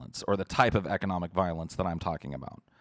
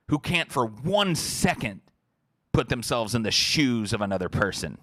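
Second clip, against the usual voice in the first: heated, sarcastic rant; ranty; 3 semitones higher than usual. Real, not acted. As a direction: rant indignantly, leaning hard on key words. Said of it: "Sorry I'm so ranty".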